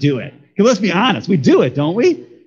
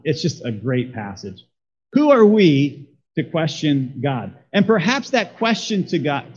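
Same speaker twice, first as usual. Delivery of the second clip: normal talking voice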